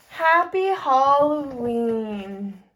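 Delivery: ordinary talk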